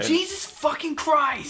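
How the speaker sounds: high, drawn-out frustrated outburst; slow, high-pitched gamer rage; exasperated cry. Real, not acted. Raging at the game.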